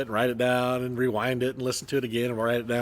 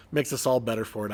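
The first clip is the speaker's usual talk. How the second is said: normal talking voice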